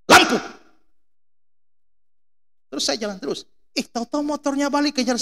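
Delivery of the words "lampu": loud, high retold bark; angry snap. Driver to passing motorbike with high beam, loud scolding shout. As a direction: shout it as a sharp rebuke. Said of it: "saya langsung bentak".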